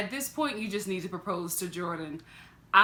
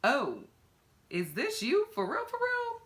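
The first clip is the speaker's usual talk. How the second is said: incredulous; 6 semitones higher than usual. Retold or imitated. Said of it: "I'm like".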